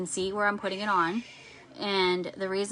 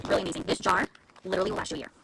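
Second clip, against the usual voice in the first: usual speaking voice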